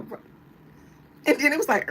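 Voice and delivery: ordinary delivery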